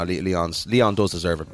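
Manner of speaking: ordinary talk, nothing marked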